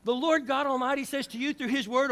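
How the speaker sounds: normal talking voice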